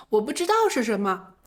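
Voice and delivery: ordinary talk, nothing marked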